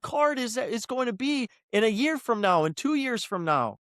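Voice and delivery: high, heated protest; yelling in argument. Real, not acted. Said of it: "now I'm getting yelled at again".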